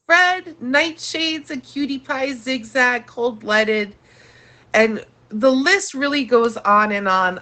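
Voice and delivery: ordinary talk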